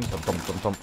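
soft heartbeat imitation. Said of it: "my heart's thumping".

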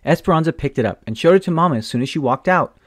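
usual speaking voice

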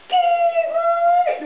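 very high, very slow drawn-out chant; mock the class chorus, stretched way out; slow chanting class greeting; sing-song good morning. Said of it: "why they say it so slowly".

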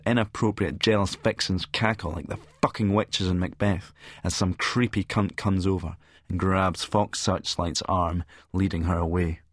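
plain reading voice

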